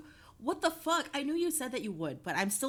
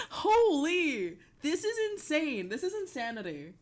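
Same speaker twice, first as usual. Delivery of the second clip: normal talking voice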